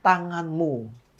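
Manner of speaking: slow, deliberate question from God; slow simple question. Retold or imitated. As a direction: ask it slowly and simply. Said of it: "cuma nanya gini".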